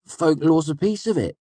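high-pitched, quick hedge; hedging. A narrator's reading. Boy eager to save face, hedging. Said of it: "I hedged quickly".

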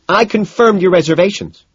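high, loud reproach; angry accusation. A narrator's reading. Evelyn reproaches him about the booking, angry accusation. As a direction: throw it at him loudly.

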